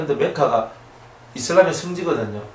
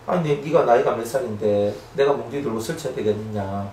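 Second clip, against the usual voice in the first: softer, lower, calm correction; calm parent; 5 semitones lower, 5 dB quieter than usual. Retold or imitated. A calm parent correcting a child quietly, gentle. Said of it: "내 마음을 차분하게 만들어가지고".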